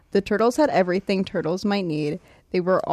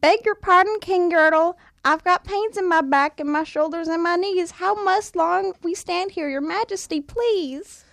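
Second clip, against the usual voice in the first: high, timid little turtle; a small, pleading turtle voice; Mac's voice; 10 semitones higher than usual. A narrator's reading. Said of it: "looked up and said".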